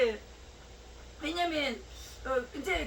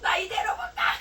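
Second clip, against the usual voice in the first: raised, higher and louder cry; higher, louder imitated outburst; retold shout; 7 semitones higher, 7 dB louder than usual. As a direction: burst out as she did, loud. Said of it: "막 소리 지르고".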